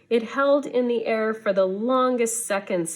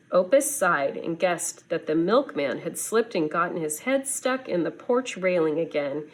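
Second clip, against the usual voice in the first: usual speaking voice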